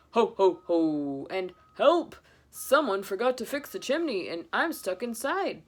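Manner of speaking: loud jolly ho-ho turning to a plea for help; stuck Santa. Acted. Santa stuck in the chimney calling for help, booming. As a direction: boom then call for rescue.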